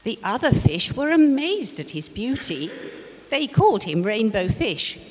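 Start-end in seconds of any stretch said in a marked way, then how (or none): none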